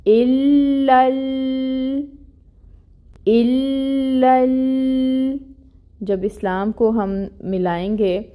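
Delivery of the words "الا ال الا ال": slow chanted الا ال-, the lam merged and held high; recited with idgham. Shown as a demonstration. Teacher shows merging the two lams, slow chant. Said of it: "اس لام سے ملا دینا ہے".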